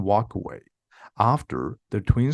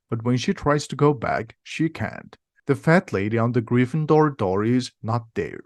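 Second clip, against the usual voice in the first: ordinary delivery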